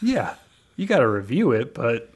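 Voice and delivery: usual speaking voice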